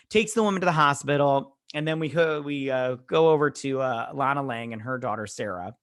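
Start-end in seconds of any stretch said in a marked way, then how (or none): none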